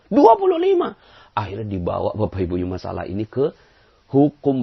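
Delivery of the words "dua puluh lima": squeaky, much higher and louder "dua puluh lima"; stubborn man refusing the correct answer; stubborn insistence. Retold or imitated. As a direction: insist like a mulish child.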